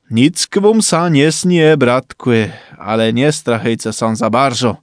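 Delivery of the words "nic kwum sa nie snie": usual speaking voice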